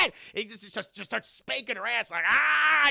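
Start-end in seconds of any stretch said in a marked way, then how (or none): 2.3-2.9 high, loud imitated shriek; mock scream. Girlfriend carried off and spanked, mock scream. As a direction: let out a put-on yelp.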